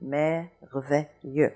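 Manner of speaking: merveilleux very slowly, every sound apart; French merveilleux. Shown as a demonstration. A teacher modelling the word very slowly. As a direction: draw merveilleux out syllable by syllable. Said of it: "So we have merveilleux".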